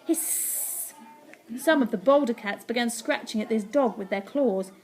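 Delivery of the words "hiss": long, voiceless hiss; hushed acted cat threat. A narrator's reading. Cats bare fangs at intruders, hissing.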